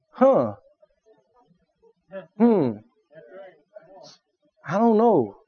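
soft, hesitant pondering with pauses; doubtful thinking. Acted. Listener sits weighing what somebody said, doubtful. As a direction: ponder it quietly, unconvinced. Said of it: "you're sitting there thinking".

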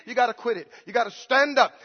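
usual speaking voice

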